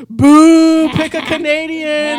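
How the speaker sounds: loud, high jeering shout; mock crowd booing. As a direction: imitate a crowd heckling the stage.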